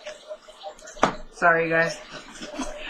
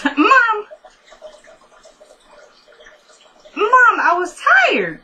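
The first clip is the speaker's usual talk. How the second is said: high, drawn-out daughterly whine; whiny protest; 13 semitones higher, 4 dB louder, slower than usual. Real, not acted. Daughter on the phone excusing herself to Ma, whiny protest. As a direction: protest to your mother in a much higher voice.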